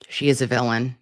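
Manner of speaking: usual speaking voice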